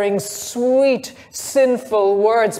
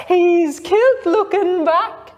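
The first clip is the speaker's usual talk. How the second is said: laughing through the words, higher; 8 semitones higher, 4 dB louder than usual. A narrator's reading. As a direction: giggle it out, barely holding together. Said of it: "she laughed".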